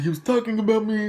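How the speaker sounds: high, quick whiny defence; mock protest. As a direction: plead your case high and fast. Retelling own protest in the office.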